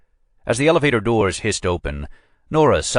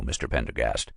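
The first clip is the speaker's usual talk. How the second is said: much lower, softer, clipped-off Mister Pendergast; cold and final; 7 semitones lower, 8 dB quieter than usual. A narrator's reading. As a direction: drop the name coldly to end it. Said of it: "as you well know".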